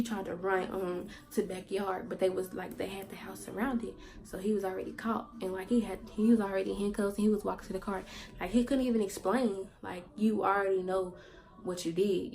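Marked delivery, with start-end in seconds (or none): none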